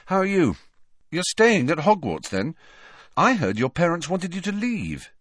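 high, chatty character voice; friendly schoolboy character. Acted. Harry making conversation with Parvati, friendly. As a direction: lift the voice into friendly questioning.

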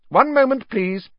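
high, loud teacher's call. A narrator's reading. McGonagall stopping pupils as they leave, calling out. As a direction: call over the departing class. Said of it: "she called".

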